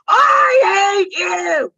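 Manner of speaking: high, loud, slow screaming cry; toddler tantrum. Retold or imitated. Toddler screaming at mom in a meltdown. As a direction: scream it like a furious three-year-old. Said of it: "she snaps, loses it".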